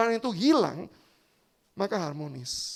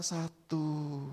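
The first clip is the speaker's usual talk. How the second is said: softer, lower calm count; 5 semitones lower, 8 dB quieter than usual. Acted. Wife calmly counting her husband's strike, soft. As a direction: count calmly as a quiet threat. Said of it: "dengan lembut membelai suaminya".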